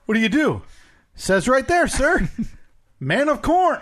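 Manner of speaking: put-on playful old-time gumshoe; noir detective. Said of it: "talk like a detective in the thirties".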